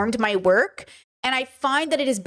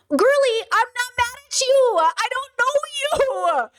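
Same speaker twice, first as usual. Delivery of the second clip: much higher, slow and emphatic; loud and passionate; 11 semitones higher, slower than usual. Real, not acted. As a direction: pour passion into every word. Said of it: "me getting loud and passionate".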